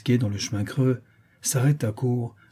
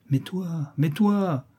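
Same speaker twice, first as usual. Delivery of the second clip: higher-pitched, pressing question; insistent questioning; 7 semitones higher, much slower than usual. A narrator's reading. La Chouette pressing the brigand on his plan, insistent. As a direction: press for an answer, pitch lifted.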